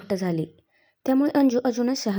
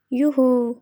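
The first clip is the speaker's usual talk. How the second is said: a quick happy "युहू"; cheering whoop; 2 semitones higher, much slower than usual. A narrator's reading. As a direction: cheer like girls clapping at a game. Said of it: "टाळ्या वाजवत ओरडल्या". (आनंदाने "युहू" ओरडणे)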